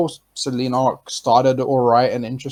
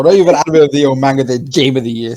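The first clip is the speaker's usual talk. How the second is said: fast, loud mock hype; excited; 8 dB louder than usual. Real, not acted. Hyping Natsu's sword as absurd.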